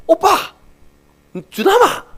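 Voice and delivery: high, loud joyful cry of names; excited calling out. Retold or imitated. Wife and daughter calling out the names in joy, high excited cry. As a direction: shout the names in sudden delight.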